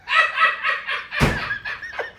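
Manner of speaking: real laugh, high and breathy. Real, not acted. The teller laughs at his own joke, high.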